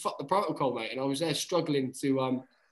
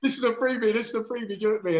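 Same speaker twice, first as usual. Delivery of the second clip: laughing glee; 8 semitones higher, faster than usual. Retold or imitated. Retold glee at free kick.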